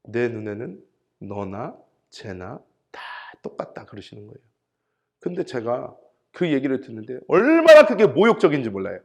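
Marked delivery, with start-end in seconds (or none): 0.0-3.8 slow, low, hushed divine verdict; 하나님. Retold or imitated. God answering the pastor's protest, quiet and measured. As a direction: deliver it softly and slowly, fading away. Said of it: "하나님이 저한테 해주신 말씀".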